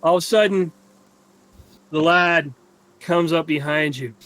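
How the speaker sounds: normal talking voice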